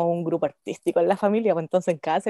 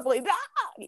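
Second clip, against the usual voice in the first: mock scream; 8 semitones higher, 5 dB quieter than usual. Imagining the sandal hitting.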